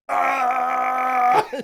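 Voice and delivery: loud, drawn-out mock scream; hammed-up action-hero yell; one long open-throated "aah"; imitated scream. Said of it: "screaming at his own fist".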